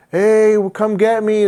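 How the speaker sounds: put-on high, loud girlish call; daughter calling.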